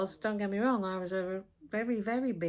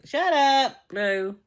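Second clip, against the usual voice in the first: loud, high yell at the dog; shouting at dog; 7 semitones higher, 12 dB louder, much slower than usual. Real, not acted. Yelling at barking dog.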